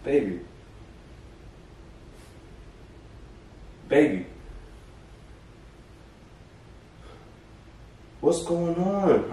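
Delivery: freaking out. Real, not acted. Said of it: "I'm freaking out".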